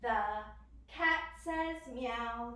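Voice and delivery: slow sing-song cat sound; slow animal sounds. Shown as a demonstration. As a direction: meow drawn out slowly. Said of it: "very first animal".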